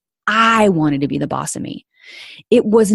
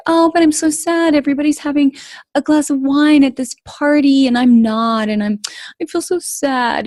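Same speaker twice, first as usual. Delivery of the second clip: much higher, self-pitying whimper; mock sad whine; 9 semitones higher than usual. Past self sulking at a party without wine, mock sad whine. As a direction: pout it out like a sulky child. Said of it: "And I'd be like".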